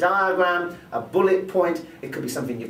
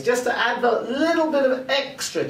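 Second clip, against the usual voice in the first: higher, lifted pitch for extra emphasis; changed pitch; 8 semitones higher than usual. Shown as a demonstration. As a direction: lift the pitch to sell it. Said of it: "changing the pitch and the pace".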